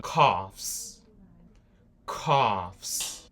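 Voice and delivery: coughs said as kofs, the final s kept audible; English plural demo. Shown as a demonstration. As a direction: model coughs for learners. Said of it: "Assim que você fala". (pronúncia de coughs com o s final)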